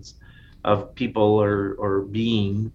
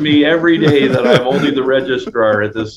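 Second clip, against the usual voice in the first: Hitchhiker's Guide robot; 5 dB louder than usual. Pretend. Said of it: "Hitchhiker's Guide to the Galaxy".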